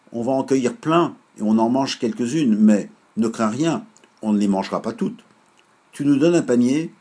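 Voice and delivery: loud, high call from the tree. Acted. Children call from the cherry tree to grandma, loud and bright. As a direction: shout up cheerfully from the branches. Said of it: "lui crièrent-ils".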